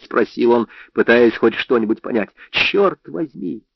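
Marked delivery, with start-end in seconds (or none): 2.5-3.6 exasperated. A narrator's reading. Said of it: "Я был вне себя".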